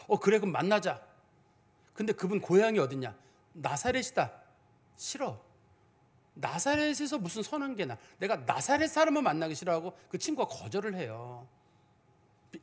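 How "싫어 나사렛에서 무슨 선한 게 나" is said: ordinary delivery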